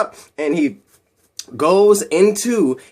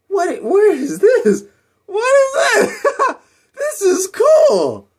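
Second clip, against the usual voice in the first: high, drawn-out giddy amazement with a laugh; thrilled discovery; 3 dB louder, much slower than usual. Acted. Hero discovering his new suit, thrilled. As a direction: marvel slowly in a much higher voice.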